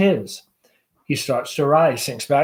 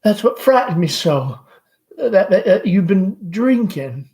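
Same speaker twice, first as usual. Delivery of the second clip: higher, fretful motherly voice; high nervous Southern mother; anxious mother Amanda; 6 semitones higher, 5 dB louder than usual. Acted. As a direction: fuss over her son, pitched up.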